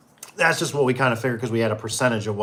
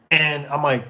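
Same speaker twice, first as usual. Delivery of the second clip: normal talking voice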